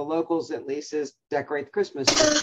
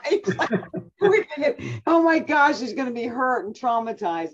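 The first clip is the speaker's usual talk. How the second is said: laughing; 9 semitones higher, 4 dB louder than usual. Real, not acted. Laughing retelling the screaming scene.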